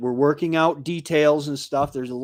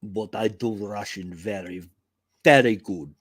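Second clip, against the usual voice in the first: mock Russian accent; 7 semitones lower than usual. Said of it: "I can't do a French accent".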